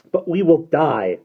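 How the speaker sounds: high, loud desperate plea; frightened girl pleading; desperate cry. Acted. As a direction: cry out that they will die. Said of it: "said Derna".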